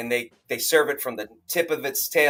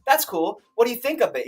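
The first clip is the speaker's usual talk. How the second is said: surprised; 9 semitones higher than usual. Retold or imitated. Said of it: "And we're like".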